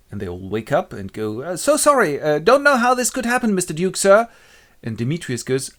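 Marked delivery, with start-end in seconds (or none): 1.4-4.3 high, loud grovelling excuse; flustered apology. Acted. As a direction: apologise nervously to a superior. Woken lovers apologise to Duke.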